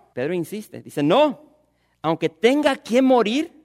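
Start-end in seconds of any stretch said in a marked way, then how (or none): 1.0-3.5 loud, high pleading insistence; slow and loud, stake your life on it; insistent vow. Acted. Peter insists to Jesus.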